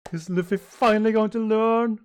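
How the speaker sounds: high, loud amazement; loud, high-pitched hopeful cry; excited outburst. Acted. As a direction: build to an excited high shout. Acted excited reaction to the story.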